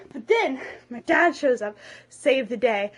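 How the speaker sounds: ordinary talk, nothing marked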